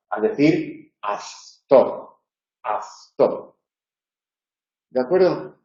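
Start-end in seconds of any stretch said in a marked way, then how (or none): 1.0-3.6 actor said as athtor, k becoming a Z; wrong take: actor with a th sound; interdental error. Shown as a demonstration. Teacher demonstrating the mistaken interdental k. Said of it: "evitar es que la relajación nos lleve a pronunciar una interdental".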